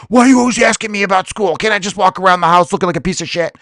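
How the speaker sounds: high, put-on bratty teen voice; whiny teenager. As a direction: son mocking his parents' questions in a bratty voice. Sulky teen son retorting to parent.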